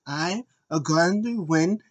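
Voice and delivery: put-on a light Japanese-accented boast; imitating Asuka's Japanese accent. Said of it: "She's like".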